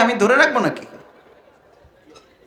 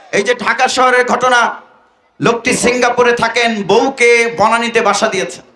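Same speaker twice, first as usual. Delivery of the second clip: usual speaking voice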